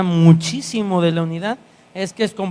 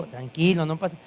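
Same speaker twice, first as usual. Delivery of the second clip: passive tone; 4 semitones lower, 7 dB quieter than usual. Retold or imitated. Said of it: "con este pasivo".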